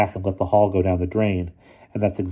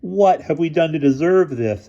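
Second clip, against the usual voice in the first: despairing; 10 semitones higher, 4 dB louder than usual. A narrator's reading. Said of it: "asked Boots in despair".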